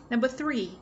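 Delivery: normal talking voice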